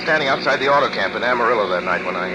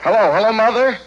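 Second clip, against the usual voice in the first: higher, louder, urgent hello; anxious call; 9 semitones higher, 5 dB louder than usual. Acted. Son calling his mother long distance, urgent and loud. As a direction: reach desperately for his mother.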